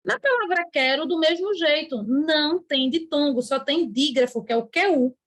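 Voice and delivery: normal talking voice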